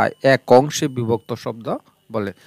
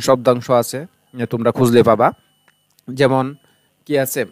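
ordinary talk

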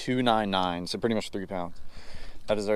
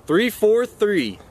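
slow, higher triumphant announcement; excited; 8 semitones higher, much slower than usual. Real, not acted. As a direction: drag it out proudly. Reading out a big weight.

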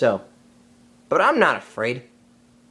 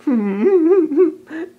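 sly snake chuckle; 6 semitones higher, 4 dB louder than usual. Acted. Kaa finds the man cub.

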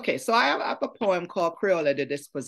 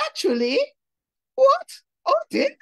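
put-on English accent, high and loud; fake foreign twang; 6 dB louder than usual. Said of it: "his foreign twang the boy was a put on".

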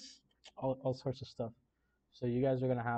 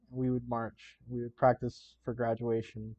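ordinary talk, nothing marked

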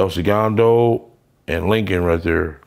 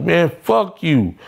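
defiant retort; 8 semitones higher, slower than usual. Retold or imitated. His own retort, retold.

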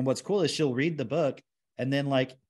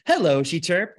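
HELLO chirped high and loud; bright-eyed reader's high, punched "Hello"; imitating emphatic reading; 6 semitones higher, 5 dB louder than usual. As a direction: mimic a child's emphatic "Hello", pitched up. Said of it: "doing it with more emphasis".

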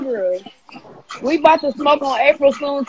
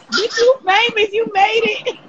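mock crying; 9 semitones higher than usual. Teasing friends about fame on live, mock sobbing. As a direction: pretend to weep with pride, hammed up. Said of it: "I'm crying".

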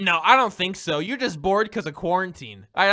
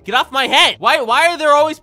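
annoyed shout; 6 semitones higher, 9 dB louder than usual. Real, not acted. Gamer shouting at a player standing on his head, annoyed shout. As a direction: yell it, louder and higher.

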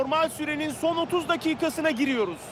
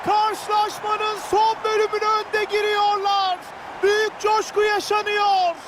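roaring goal call, much higher and louder; screaming football commentator; 7 semitones higher, 3 dB louder, slower than usual. Real, not acted. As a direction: belt out the goal, stretching it. Goal scored, commentator erupts.

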